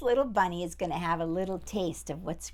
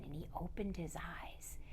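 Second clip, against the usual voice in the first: hushed whisper; quiet whispered narration; 3 semitones lower, 13 dB quieter than usual. A narrator's reading. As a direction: breathe it out softly. Cat wakes in the dark.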